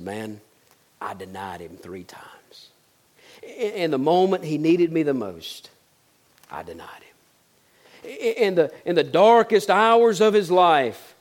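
ashamed, barely getting the words out; broken confession. Retold or imitated. Peter confides to John his denial, hushed and broken.

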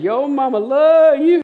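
very high, loud, stretched-out holler; imitated yell. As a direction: mimic her hollering to the rafters. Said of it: "I'm not exaggerating how she said it".

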